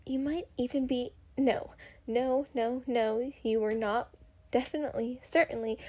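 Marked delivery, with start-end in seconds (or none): none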